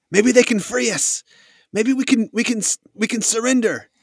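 high-pitched, stammering plea; frightened soldier. Acted. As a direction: suggest it in a nervous high voice. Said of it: "you hear another soldier say".

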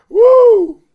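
loud, high whoop; excited whoop. Real, not acted. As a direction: let out a big whoop. Hyped shout about the money.

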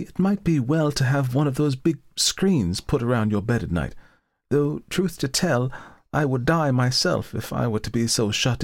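put-on high, fretful old woman; an anxious landlady, rising to fear; nervous landlady voice. Landlady's fearful parting advice.

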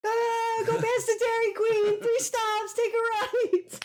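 high, wailing shouted directions; mock psychic distress call. Psychic face giving dad directions, high mock wail. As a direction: cry out each direction in hammed-up agony. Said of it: "the psychic image comes back".

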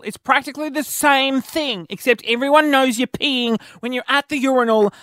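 high-pitched, theatrical scolding; exasperated rant. Acted. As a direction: plead and lecture in mock outrage, easing off gradually. Acted rant at colleague.

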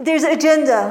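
says it loud and high; loud emphatic. Real, not acted. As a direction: hit the word agenda hard. Sudden loud emphatic line.

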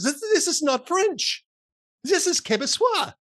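loud, high, slow mock indignation; offended Frenchman. French boss offended at the demo, indignant and emphatic. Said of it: "suddenly gets very offended".

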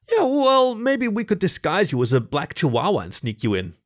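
high "yeah, well", then jokey patter; quipping. A narrator's reading. Topher joking about sneaking LaShonda in, quipping. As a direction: leap up high, then ease into the quip. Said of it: "Topher quipped".

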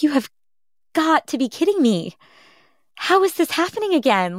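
retelling her own shock at the bleeding; disbelief. Retold or imitated. Her heart sank at the news.

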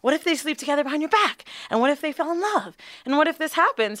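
high worried mom voice, fast; imitating mom. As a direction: play mom fretting about the boyfriend. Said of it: "even my mom was like".

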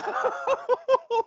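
high burst of laughter; real high laugh. Real, not acted. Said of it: "laughing at his story".

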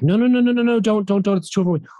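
high, rapid-fire mock panic; imitating panicked resistance. Actor acting out fighting a panic attack, panicked pleading. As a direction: act out pleading against an oncoming wave. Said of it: "You so wanna be like".

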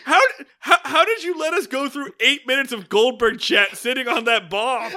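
laughing incredulity. Real, not acted. Co-host protests eight minutes of chat sitting on that bomb, incredulous. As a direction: demand it through laughter, incredulous. Berates friend for withholding twist.

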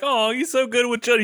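high, sugary mock admiration; gushing onlooker. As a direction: coo it like a doting parent.

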